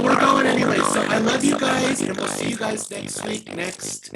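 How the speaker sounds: very low, loud doubled-sounding voice; Luann voice. Real, not acted. Host signing off to viewers, very low Luann-like voice. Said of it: "[name]'s got the Luann voice".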